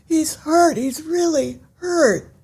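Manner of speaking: high, tearful, breaking plea; choked-up character line. Acted. Podcast author voicing her character Cynthia, choked-up and tearful. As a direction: voice a grieving character, fighting back tears. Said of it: "and Cynthia says".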